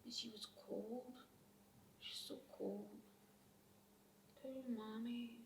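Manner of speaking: very soft, fading voice; small, hushed remembering; hushed grief. Acted. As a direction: say it almost under your breath. Child remembers her dead mother.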